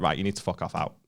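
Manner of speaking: low, soft imitation of an ejection; discreet firm warning. Colleague intercepts to throw them out, quiet and firm. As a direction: quietly order them out, keeping it private.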